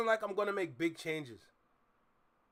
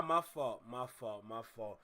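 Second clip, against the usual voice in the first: each my fault softer and lower until it dies away; fading apology; 11 semitones lower, 8 dB quieter than usual. Real, not acted. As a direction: trail off the apology into a mumble.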